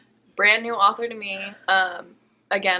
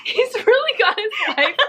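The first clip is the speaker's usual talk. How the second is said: much higher, fast, giggling through it; laughing tease; 13 semitones higher, 3 dB louder, much faster than usual. Real, not acted. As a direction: tease her while laughing. Teasing about the crush.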